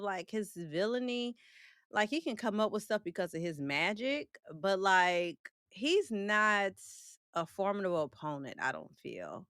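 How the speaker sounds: normal talking voice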